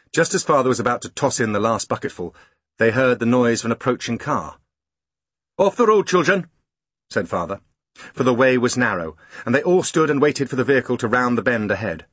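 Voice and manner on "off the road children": raised, higher urgent call. Acted. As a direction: call the children off the road. Father warns children of car.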